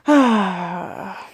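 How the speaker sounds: long, loud exhaled sigh; heavy sigh. Real, not acted. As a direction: let out a big sigh. Sighs while pondering the danger.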